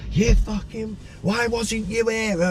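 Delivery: high, drawn-out mock outburst; replaying her tearful attack; imitating girlfriend's outburst. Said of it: "attacking me, going".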